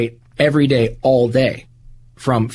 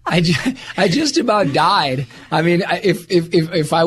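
excited; 6 semitones higher than usual. Real, not acted. Excited after celebrity encounter.

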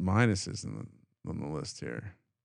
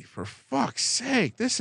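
exasperated groan; 14 semitones higher, much slower than usual. Real, not acted. Reacting to tough road.

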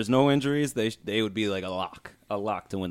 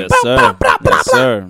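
yes sir yelled loud, first one much higher; excited shout; 9 dB louder, much slower than usual. Real, not acted. Podcast hosts hyping free agency, excited yelling. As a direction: cheer it like a hype man, loud.

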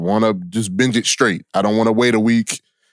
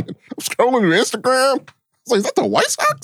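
mock crying; 13 semitones higher than usual. Mock lament continues.

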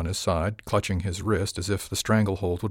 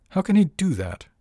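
shocked question; 10 semitones higher, 5 dB louder than usual. Acted. Captain shocked at the news.

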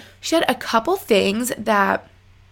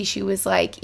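ordinary delivery

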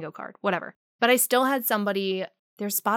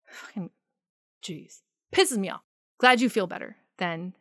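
ordinary delivery

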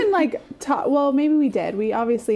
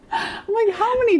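laughing; 10 semitones higher than usual. Real, not acted. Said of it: "I know".

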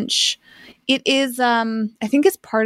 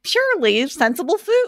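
higher, sing-song take on "Purely sensible food."; higher Jason voice; 8 semitones higher than usual. Retold or imitated. Host showing her co-host how his voice really goes, higher and sing-song. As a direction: pitch it up like a cheery tagline. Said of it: "you have a little bit of a different voice".

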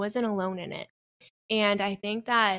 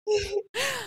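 a sudden higher burst of laughter; 13 semitones higher than usual. Real, not acted. Guest laughing about her grandfather's mall trip, high burst of laughter.

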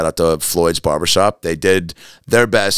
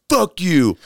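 high-pitched imitation of an outburst; mock angry insult; 11 semitones higher than usual. Angry man before spitting on the comic, imitated outburst. Husband's retold angry confrontation.

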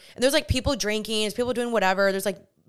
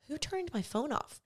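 higher, very soft retold question; hushed suspicion; 5 semitones higher, 12 dB quieter than usual. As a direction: ask it under your breath. Retelling her silent suspicion.

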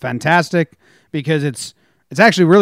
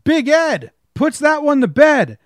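much higher, loud announcer call; home run call; 7 semitones higher, 4 dB louder, slower than usual. Retold or imitated. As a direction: belt it out like a broadcaster. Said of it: "My top was".

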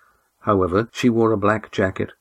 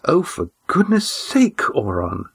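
tired, testy exasperation; 9 semitones higher than usual. A narrator's reading. Miasa cuts off Oron, tired and testy. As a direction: brush him off like a weary old woman. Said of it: "with the testiness of the very tired".

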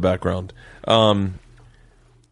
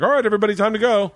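a notch higher, calling the room to leave; wife wrapping it up; 10 semitones higher, much faster than usual. Retold or imitated. Wife telling the guests it's time to go, calling out. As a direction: round everyone up like the wife.

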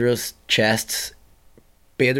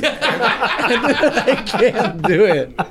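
laughing; 9 semitones higher, much slower than usual. Real, not acted. Fails tongue twister, laughing.

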